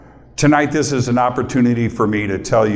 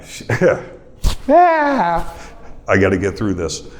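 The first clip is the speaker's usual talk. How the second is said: tearful laugh. Real, not acted. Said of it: "Okay, I got tears".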